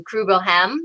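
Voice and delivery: normal talking voice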